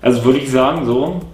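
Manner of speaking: mouthpiece pressed to lips; words partly obstructed at lips; speaking around hookah mouthpiece. Real, not acted. Seen 1: Mouthpiece held at lips throughout labelled words; absent in R frames.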